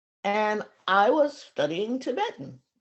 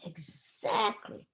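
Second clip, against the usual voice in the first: exactly, whispered slow with a rise; soft whispered exactly; 3 semitones lower, 6 dB quieter, slower than usual. Retold or imitated. Lama quietly repeating exactly in a whisper. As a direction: breathe exactly softly. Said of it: "repeated word, two deliveries".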